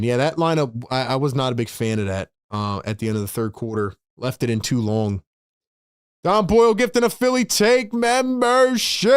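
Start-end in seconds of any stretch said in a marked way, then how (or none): 6.2-9.1 high, energetic shout-out; excited, raised-pitch streamer call-out; hyped announcement. Real, not acted. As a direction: announce a gift to the crowd.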